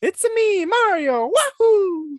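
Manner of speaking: very high, loud cartoon plumber; Mario. Shown as a demonstration. Host showing the game hero, high exuberant catchphrase. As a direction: squeal it high, ending on a whoop. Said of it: "that was O G".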